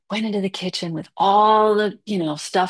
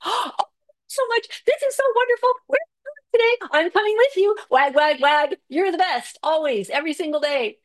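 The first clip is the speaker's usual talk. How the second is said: much higher, overjoyed dog voice; golden retriever; 11 semitones higher than usual. Pretend. Describing her higher power as a golden retriever, gushing high dog voice. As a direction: gush like a delighted golden retriever. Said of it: "a lot like a golden retriever".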